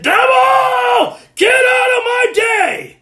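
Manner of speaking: very high, loud, drawn-out rebuke; full-force shout. Shown as a demonstration. Driver after a bad morning rebuking the devil, shouting. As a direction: bellow it at the devil, stretching the words. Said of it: "learn how".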